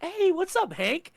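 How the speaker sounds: high, breezy mock greeting; mock casual Walt. Walt greeting Hank innocently, mock high breezy voice.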